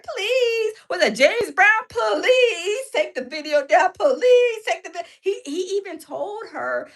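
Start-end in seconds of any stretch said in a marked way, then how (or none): 0.0-0.7 imitating a tearful plea; mock crying plea; mock begging. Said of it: "he was like". 1.9-5.0 imitating a crying plea, slower; hammed-up crying plea; mock tearful begging. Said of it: "He was begging him".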